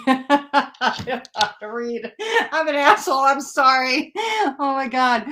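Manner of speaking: high, laughing apology; laughing at herself. Real, not acted. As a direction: apologise through helpless laughter. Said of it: "I'm laughing at me".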